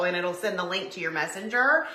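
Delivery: ordinary talk, nothing marked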